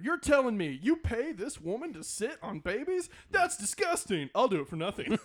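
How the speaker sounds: loud, high comic cat voice; hammy Cat in the Hat; Mike Myers as the Cat. Retold or imitated. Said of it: "he'll say the entire line that Mike Myers says".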